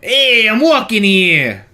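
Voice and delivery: high, slow, loud New York tough-guy voice; Al Pacino impression. Movie guy yelling at the taxi, loud New York voice. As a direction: bark it like a New Yorker at a cab. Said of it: "Al Pacino".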